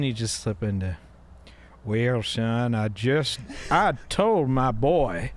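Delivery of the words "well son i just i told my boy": drawling Southern dad; Southern accent. Acted. Southern father lecturing his boy on grades, Southern drawl. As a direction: stretch it into a whiskey-soaked Southern drawl. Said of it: "imitating Southern people is drinking whiskey".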